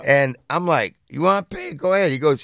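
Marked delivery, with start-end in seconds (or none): 1.1-2.1 higher, mocking dare; mock taunt, pitched up; daring challenge. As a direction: go ahead, call his bluff. Speaker dares the stranger.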